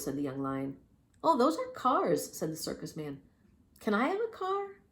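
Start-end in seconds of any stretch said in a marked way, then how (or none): 1.2-2.4 high, slow showman; slow grand showman; circus man voice. Acted. 3.8-4.8 very high, eager cub; high eager young lion. Acted.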